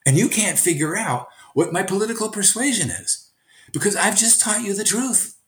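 teacher explains his point to his student, excited and high. Retold or imitated. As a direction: make your point with delight. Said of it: "And I said".

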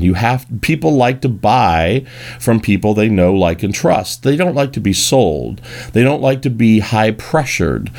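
normal talking voice

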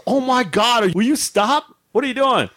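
high, pleading mock scolding; exasperated wife. Wife seeing husband on freezer's edge, mock exasperation. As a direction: imitate a wife who has had enough.

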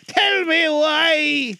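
high, loud, slow plea; frightened Scrooge. Acted. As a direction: beg the ghost slowly. Scrooge pleading with ghost.

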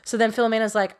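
ordinary delivery

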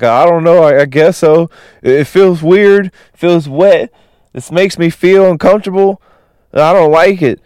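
high-pitched, upset character voice; imitated crying. Manny telling Hank the tears feel weird, mock tearful. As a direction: play someone confused by their own tears. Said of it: "Manny is crying".

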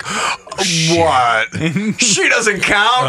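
high, drawn-out indignant question; mock bafflement. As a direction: play the dad's exaggerated disbelief. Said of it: "and then he's like".

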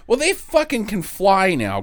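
normal talking voice

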